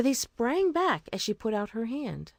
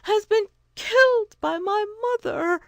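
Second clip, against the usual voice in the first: tearful pleading; 11 semitones higher, 5 dB louder than usual. A narrator's reading. Two Eyes telling the wise woman her goat is dead, weeping. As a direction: sob it out, barely holding together. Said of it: "she replied".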